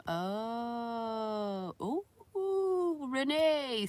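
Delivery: high, drawn-out excited oohs; high whooping call. Real, not acted. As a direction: sing out the name with rising excitement. Calls out to a viewer.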